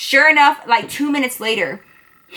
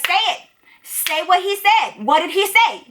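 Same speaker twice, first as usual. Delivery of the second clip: higher-pitched, pressing retold challenge; insistent demand; 5 semitones higher than usual. Narrator pushes his friends to repeat his insult, insistent.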